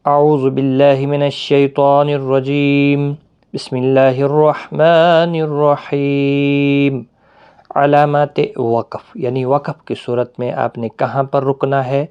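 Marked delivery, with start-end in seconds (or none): 0.1-7.0 slow, drawn-out sacred recitation; Quranic recitation. Real, not acted. Teacher opens the lesson with the invocation, slow recitation. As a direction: intone it slowly with long-held vowels.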